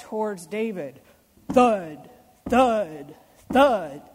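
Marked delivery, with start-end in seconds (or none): 1.5-4.0 louder stomping thud, thud, thud; heavy thuds. Acted. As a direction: stomp each word like a giant's foot. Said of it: "hearing how I'm reading the story".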